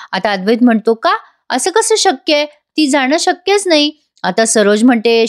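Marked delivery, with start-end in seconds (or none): 0.9-3.9 higher, stunned and slowed on the last line; can't believe she has gone; shocked disbelief. Retold or imitated. Son shocked she left. (धक्का बसलेला, उंच आवाजात अविश्वास)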